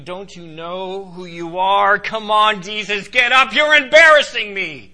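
urgent, high protest; incredulous Peter. Acted. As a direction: build to an embarrassed outburst. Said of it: "Peter was incredulous".

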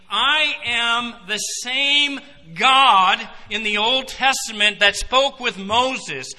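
slow, loud, weighty declaration; Jesus declaring divinity. Acted. Preacher voicing Jesus to the Pharisees, slow booming claim. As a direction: stretch each word out like a proclamation.

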